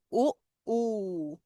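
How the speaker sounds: short ओ, then long stretched ऊ; brief ओ against held ऊ; two OO sounds. Shown as a demonstration. Teacher recapping double-O, short ओ then long ऊ. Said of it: "दो तरह के sound".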